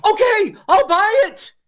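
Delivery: high, loud squeal of giving in; caving buyer. Retold or imitated. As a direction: blurt it out in a shrill panic. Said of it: "He goes".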